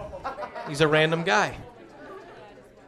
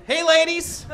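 smooth greeting; 7 semitones higher than usual. Acted.

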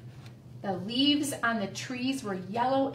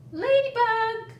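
calling out; 11 semitones higher, 5 dB louder than usual. A narrator's reading. Woodchuck to Ladybug high in the maple, calling out. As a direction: call up to someone on a branch. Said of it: "called Woodchuck".

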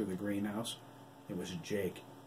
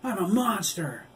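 high, loud anguished scream; tortured scream of self-hate; 11 semitones higher, 8 dB louder than usual. Acted. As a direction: scream it at the ceiling. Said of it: "He screamed up".

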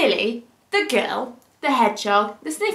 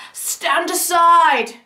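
higher, louder, slow stand aside; commanding man; 5 semitones higher, 5 dB louder, much slower than usual. Acted. Man with the sniffy dog clears the way, commanding. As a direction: order them aside slowly. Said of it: "said the man".

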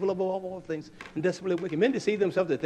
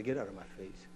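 lower, softer husband brush-off; low gruff dismissal; 6 semitones lower, 10 dB quieter, faster than usual. Retold or imitated. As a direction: growl it low to the wife. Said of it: "That's where I say".

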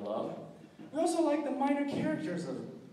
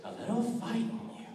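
menacing villain; 5 semitones higher than usual. Acted. Cackling villain telling a captive about his scars. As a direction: taunt a victim, giggling madly, then drop to a whisper. Unnamed villain impression, scarred-face monologue.

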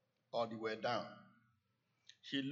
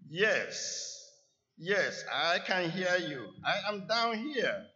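eager answer; 5 semitones higher, 5 dB louder than usual. A narrator's reading. Jack answers from the cliff.

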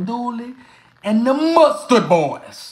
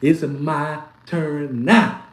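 slow gloat; 6 semitones lower, much slower than usual. Acted. Gloating at rival fans.

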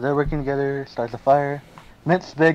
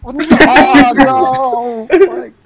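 high, loud oh no and curse; dismayed shout; 10 semitones higher, 11 dB louder, slower than usual. Real, not acted. Streamer watching his tribute die, yelling in frustration.

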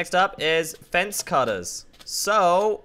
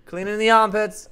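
ordinary talk, nothing marked

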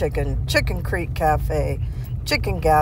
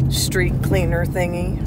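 ordinary delivery

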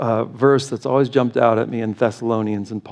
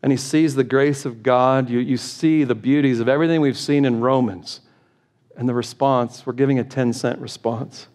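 ordinary talk, nothing marked

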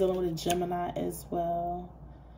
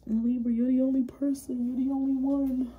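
higher whiny plea, lightly hammed; mock needy ex; 6 semitones higher than usual. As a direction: tease the clingy ex. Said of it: "that's how this person's showing up".

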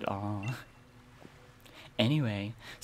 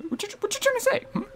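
very high, squeaky put-on voice; very high, squeaky mock-offended retort; squeaky character voice; much faster than usual.

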